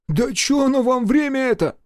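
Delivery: high-pitched, whining protest; exasperated high retort; acted bickering. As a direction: push back, pitched well up. Mechanic bickering back at his boss.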